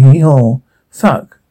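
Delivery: plain reading voice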